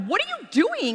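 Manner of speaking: retelling her shock at finding the products swapped; mortified outburst. Retold or imitated. Wife confronting her husband, in high mock outrage.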